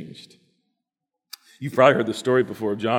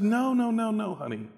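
much higher hurried no's; hasty husband; 9 semitones higher, 3 dB quieter than usual. Acted. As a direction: rush to refuse her. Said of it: "And John said".

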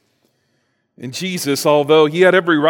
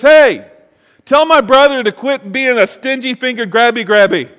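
higher, loud whiny yell; yelling from the crowd; 8 semitones higher, 6 dB louder than usual. Retold or imitated. Man in crowd yelling to Jesus about his brother, loud whine. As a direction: shout it over the crowd like a sulky sibling. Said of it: "what he yells out".

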